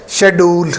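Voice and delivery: शेड्यूल as "she-DOOL" with a d sound; sh start, then "dool" in शेड्यूल; Pakistani schedule. Shown as a demonstration. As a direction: say शेड्यूल with a plain d, no j. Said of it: "डी की sound आती है".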